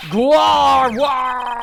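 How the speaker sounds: loud, sustained roar held until the breath runs out; long drawn-out yell. Acted. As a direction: hold the yell until you almost pass out. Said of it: "almost passed out from lack of air".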